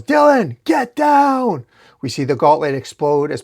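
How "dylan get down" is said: very high, loud warning shout; full-out high warning cry; urgent yell. A narrator's reading. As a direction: yell to make them duck. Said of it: "as he yells".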